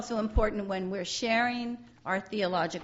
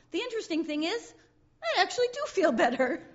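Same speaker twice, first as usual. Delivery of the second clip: laughing through the line; 9 semitones higher, 4 dB louder than usual. Acted. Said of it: "he says".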